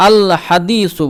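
"আল হাদিসু" slowly, with a full, clear l; slow model reading of "আল হাদিসু", lam intact; lam fully pronounced. Shown as a demonstration. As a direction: sound out the whole l of "আল" before "হাদিসু". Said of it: "লামটা পূর্ণভাবে উচ্চারণ হবে".

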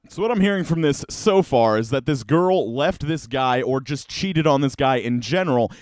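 usual speaking voice

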